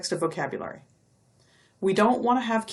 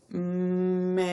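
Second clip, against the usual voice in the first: "Man" drawn out slowly; stretched slowly. Shown as a demonstration. Teacher stretching the word so kids count its sounds. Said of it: "how many sounds were in there".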